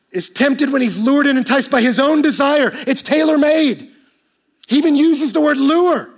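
loud, high, driving sermon delivery; preaching at full force. Acted. Pastor pressing the congregation about loneliness, at full force. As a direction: preach it out, building.

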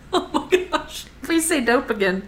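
breathless, high laughing reaction. Real, not acted. Host reacting to co-host saying dope, laughing. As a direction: gasp it out through laughter. Reacting to co-host's drug joke.

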